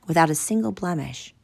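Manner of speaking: plain reading voice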